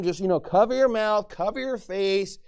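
ordinary talk

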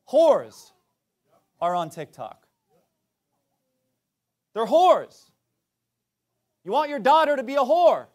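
loud shouted condemnation; shouted preaching. Acted. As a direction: bark it out at the pews.

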